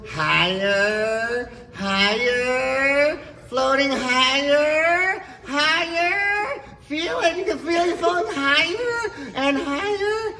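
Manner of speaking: loud, drawn-out calls climbing very high; building excitement. Acted. Hypnotist floats subject ever higher.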